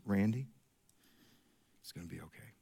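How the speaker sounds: very soft, low, tender reassurance; gentle father. Retold or imitated. Father comforting his son Randy in his room, tender hush. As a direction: comfort a scared boy gently. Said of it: "That, that gentle".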